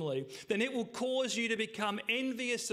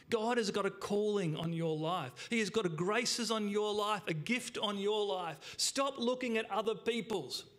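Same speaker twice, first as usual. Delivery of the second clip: normal talking voice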